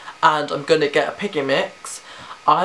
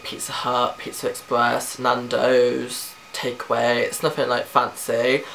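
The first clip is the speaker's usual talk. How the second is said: ordinary talk, nothing marked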